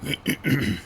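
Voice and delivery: gruff clearing of the throat; throat-clearing grunt. Real, not acted. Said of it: "Sorry about the grunting again".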